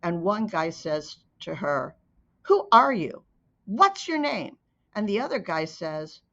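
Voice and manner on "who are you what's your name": sharp, louder police demand; harsh questioning. Acted. Police officer interrogating a scared child, harsh and loud. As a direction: bark the questions at the frightened girl.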